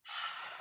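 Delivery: big unvoiced sigh of release; dramatic sigh. Shown as a demonstration. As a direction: sigh it all out, letting go. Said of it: "A big sigh".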